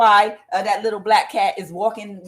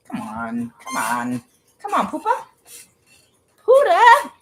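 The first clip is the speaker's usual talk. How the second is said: high sing-song call to the cat, much higher; calling the pet; 7 semitones higher, 4 dB louder than usual. Real, not acted. Calling her cat over.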